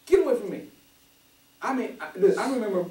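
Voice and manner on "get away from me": emphatic no. Shown as a demonstration. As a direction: shove the words out like a hand off you. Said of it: "It has to be with fervor".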